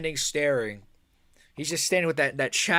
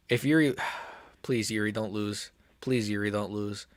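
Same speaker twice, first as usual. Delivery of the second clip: quiet plea; 6 semitones lower, 7 dB quieter than usual. Real, not acted. Nervous fan pleading.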